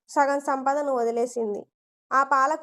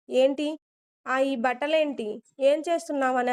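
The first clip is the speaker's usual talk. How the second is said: plain reading voice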